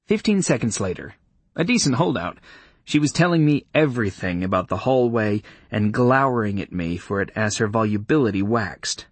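ordinary delivery

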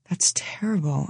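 hushed, breathy whisper; whispered aside to yourself. Acted. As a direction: say it under your breath, appalled. Narrator's private horrified aside.

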